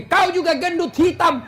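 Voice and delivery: high-pitched mock retort; hammed-up quarrelling, pitched well up throughout; acted angry wife. Wife fires back at insulting husband, mock anger. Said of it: "ibu juga marah".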